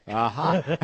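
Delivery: bursting into high laughter; real laugh. Real, not acted. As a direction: laugh it out, caught off guard. Amused on learning mother link.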